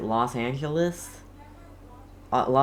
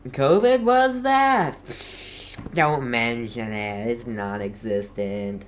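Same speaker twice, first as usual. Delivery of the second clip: mock dismissive voice; slower than usual. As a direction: play someone waving the pandemic away. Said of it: "Just, like".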